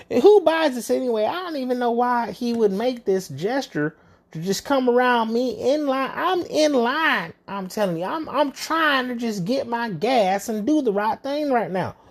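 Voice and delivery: high, whiny lament about a lost cat; mock whiny old lady. Imitating the older lady pouring out her troubles, high and whiny. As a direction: ham up a self-pitying old woman, pitched high.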